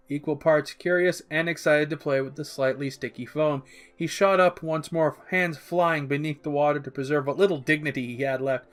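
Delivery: usual speaking voice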